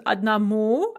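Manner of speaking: "одному" stressed on the last syllable, ad-na-MU; stress on final u. Shown as a demonstration. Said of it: "note the stress on the u".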